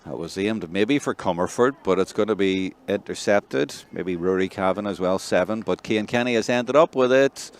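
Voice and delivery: live play-by-play. Real, not acted. Commentator calls a hurling attack, rising excitement.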